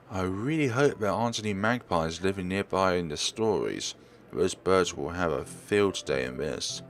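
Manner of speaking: ordinary talk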